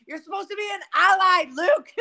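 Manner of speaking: high, loud scolding from the video; mock outrage. Quoting a viral video of squabbling brothers, mock outrage. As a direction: imitate an indignant yell, half laughing. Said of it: "It's like".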